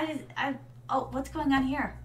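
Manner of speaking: ordinary talk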